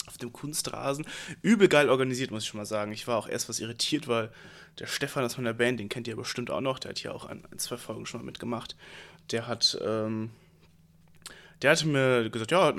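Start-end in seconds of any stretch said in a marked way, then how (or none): none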